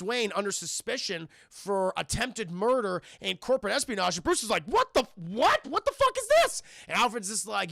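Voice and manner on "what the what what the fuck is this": high, loud disbelief; shocked outrage. Retold or imitated. Bruce reads the accusation in the paper, shocked outburst. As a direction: act stunned, voice shooting up.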